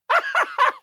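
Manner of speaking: high giddy mad scientist laugh; maniacal cackle. Acted. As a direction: cackle with delight. Mad scientist gloating over tomahawk.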